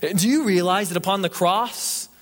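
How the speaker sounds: high, forceful sermon delivery; full-force preaching. Real, not acted. Preacher on the Savior holding his tongue, impassioned. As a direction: drive each phrase home to the congregation.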